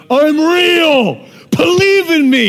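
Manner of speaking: loud, high mock divine shout; mock divine proclamation; booming shout. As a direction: boom it like God showing off. God shouting from the sunset.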